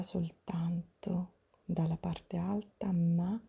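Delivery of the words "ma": ordinary talk, nothing marked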